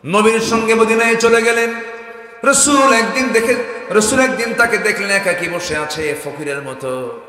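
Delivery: loud, high storytelling cry, settling at the end; full-force preaching. Real, not acted. Preacher telling of the companion's poverty, loud impassioned preaching. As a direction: belt out the story to a crowd.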